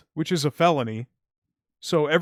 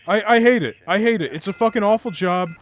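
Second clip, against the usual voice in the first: real heated outburst, louder and higher; 5 semitones higher, 7 dB louder than usual. Real, not acted. Streamer venting to viewers about an awful delivery job, heated. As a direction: vent it loudly, worked up. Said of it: "I'm getting a little heated".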